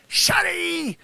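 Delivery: high, loud mock rant; hammed-up angry tirade at Sonny; comic angry shout. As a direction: rant at him at full volume.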